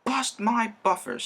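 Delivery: high exasperated cry. Acted. Thomas fed up with James, a high exasperated cry. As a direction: throw it up high in disgust. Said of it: "said Thomas".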